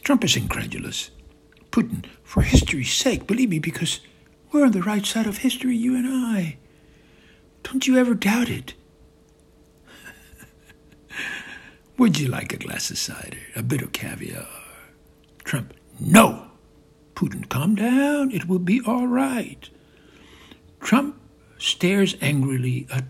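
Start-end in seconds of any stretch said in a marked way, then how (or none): 2.2-8.7 high, insistent conviction; Putin, fervent persuasion. Acted. 17.5-19.7 high-pitched placating voice; Putin, soothing. Acted. Putin soothing an angry Trump.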